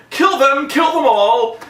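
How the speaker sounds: high, loud hammy shout; mock villain shout. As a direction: bark the order like an operatic villain. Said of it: "he's like".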